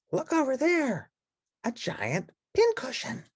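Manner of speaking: high, hushed, gentle bird voice; Big Bird voice. Acted. Big Bird showing the nest, hushed and high.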